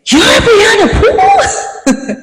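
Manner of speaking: slow, high, disbelieving question with a laugh; surprised cop. Retold or imitated. Cop surprised the small girl is Brianna, disbelieving. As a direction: stretch it out in amused disbelief. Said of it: "the cops was even surprised".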